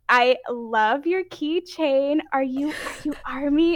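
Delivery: high, loud and slow gushing; excited. Retold or imitated. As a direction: gush over the keychain. Retold excited compliment.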